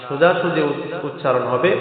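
normal talking voice

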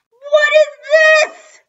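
very high, loud, drawn-out wail; anguished wail. A narrator's reading. Dad finding his toy broken, wailing in horror. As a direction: wail it slowly at the top of the voice. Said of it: "he wailed".

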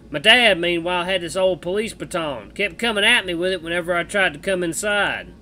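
put-on loud, drawling cowboy accent; Misha cowboy accent. Streamer voicing Misha the bat to Jenna, cowboy accent. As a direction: play the bat as a rough cowboy, American West accent. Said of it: "cowboy accent for Misha".

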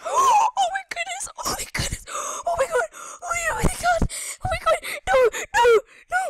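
high, loud panicked shrieks; real terrified yelling; panicked screaming. Real, not acted. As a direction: scream it while running for your life. Chased in TNT game.

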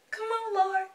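high-pitched plea; pleading. Real, not acted. Fan waiting in the ticket queue, pleading high.

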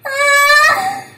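loud high fright scream; real jump-scare yelp; sudden scared shriek; startled scream. Real, not acted. Scared by something in game.

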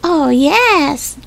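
put-on high little-boy politeness; drawn high, dutiful small-boy reply; polite agreement. A narrator's reading. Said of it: "agreed Benny politely".

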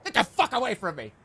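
high, whiny mock outburst; hammed-up, strained high tantrum; weird CEO. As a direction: shoo everyone off like a petulant boss. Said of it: "I don't wanna be the weird C E O guy".

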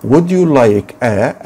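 very slow, deliberate drill; slow classroom modelling; slow model phrase. Shown as a demonstration. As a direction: model it word by word. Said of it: "تعالى هنا كده".

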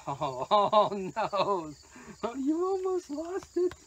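high-pitched doggy-talk dismay; high, drawn-out oh no to a dog; playful dismay. Real, not acted. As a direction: exclaim in a high pet-talk voice. Reacting to dog nearly losing food.

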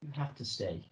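soft, low take on the line; quiet plea. Shown as a demonstration. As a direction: pull it down to a hush. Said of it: "Can you say it quieter?".

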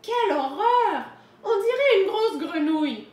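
high, loud storybook wolf outcry; horrified exclamation. A narrator's reading. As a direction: cry out in horror at your reflection. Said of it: "et s'exclama". (exclamation horrifiée, forte et aiguë)

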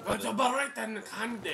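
put-on high, loud, botched Irish accent; attempted Irish accent. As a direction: try an Irish accent and miss. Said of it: "Are you trying to do an Irish accent?".

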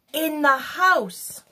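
a hint of a little mouse's squeak; squeaky mouse. A narrator's reading. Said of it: "squeaked the mouse".

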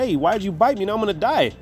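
a hint of a wounded woman's voice; woman bitten by snake. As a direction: play the hurt woman asking why.

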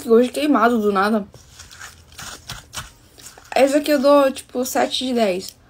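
tasting between spoonfuls; speaking after a bite; mouth partly full. Real, not acted. Seen 3: Bite at -2.0s; stirring cup, mouth working between spoonfuls.

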